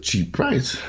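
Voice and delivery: ordinary delivery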